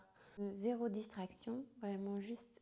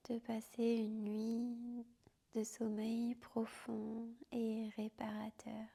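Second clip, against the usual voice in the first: guided sleep meditation. Acted. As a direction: whisper them gently down into sleep. Yoga nidra guided to sleep.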